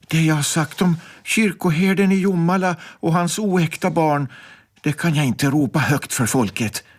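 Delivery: ordinary delivery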